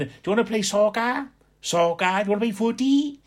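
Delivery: high, quick Valleys Welsh lad asking; imitating the Valleys boys' Welsh lilt; Welsh Valleys accent. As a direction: invite him to play in a lilting Valleys Welsh. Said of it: "a different accent".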